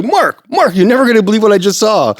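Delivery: high, fast, loud breathless news; acted-out high-pitched excitement; excited friend. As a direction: burst in bursting to tell it.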